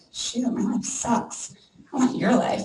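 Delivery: slow, breathy envious whisper; a jealous friend whispering over coffee; whispered envy. Retold or imitated. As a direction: sigh it out with quiet envy. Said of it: "They're like".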